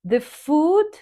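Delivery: The food lifted high and held; rising tone. Shown as a demonstration. Teacher showing rising tone for a list item. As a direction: lift The food and pause. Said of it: "Then you go with a long pause".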